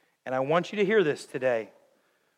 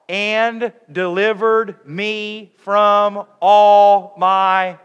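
drawn out, much louder, higher; leading a loud reading; 8 semitones higher, 15 dB louder, much slower than usual. Real, not acted. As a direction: stretch each word to lead the crowd. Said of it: "Let's read that together".

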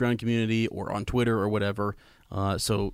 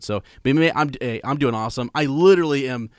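normal talking voice

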